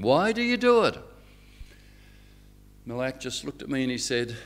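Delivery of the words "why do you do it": amazed. Retold or imitated. Amazed question to host.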